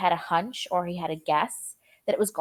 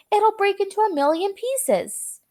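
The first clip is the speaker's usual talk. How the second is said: much higher fearful cry; panicked old man; 11 semitones higher than usual. A narrator's reading. Grandpa Joe warns of breaking glass, high and fearful. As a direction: fear it will shatter.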